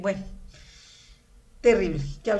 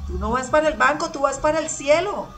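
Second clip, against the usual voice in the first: playful correction; 4 semitones higher, 7 dB louder than usual. Retold or imitated. Husband's catchphrase to people.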